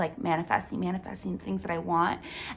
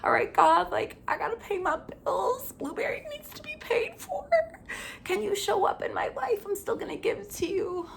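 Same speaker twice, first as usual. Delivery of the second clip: high-pitched, hammed-up tearful pleading; mock crying prayer; 11 semitones higher than usual. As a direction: whimper a plea to God, as if bawling. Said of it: "when I'm, like, crying in the morning".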